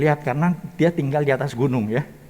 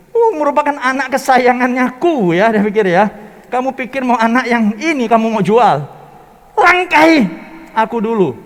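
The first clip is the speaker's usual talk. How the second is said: higher, louder indignant protest; a wife's raised indignant retort; offended wife; 8 semitones higher, 8 dB louder than usual. Acted. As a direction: snap back at husband in outrage. Wife offended, defends her favourite.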